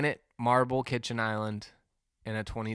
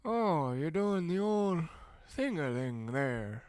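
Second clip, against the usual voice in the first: put-on old worker cornering a younger one, slow rambling old-man voice; high, drawn-out old man's drone; 6 semitones higher, slower than usual. Old man watching me work, slow high-pitched commentary. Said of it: "And then he goes".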